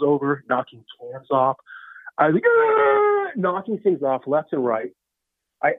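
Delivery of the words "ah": long high-pitched wail; wild yell. Acted. Rusty act knocking store aisles over, wild high wail. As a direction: let out a long, high cry.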